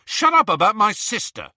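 high, loud angry snap; rough. A narrator's reading. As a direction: cut in roughly at full voice. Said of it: "said Ron roughly".

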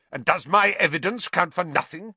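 snarl. A narrator's reading. Professor whose evidence is ignored, snarling. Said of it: "snarled Snape".

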